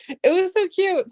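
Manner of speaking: high, gushing it was so cute. Real, not acted. Telling chat about a date with Vic, gushing high. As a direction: swoon over the memory.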